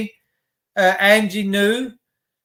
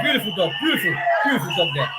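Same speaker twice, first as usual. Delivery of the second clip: laughing fondly; 3 semitones lower, 4 dB quieter, much faster than usual. Real, not acted. Fond laugh at dog on stream.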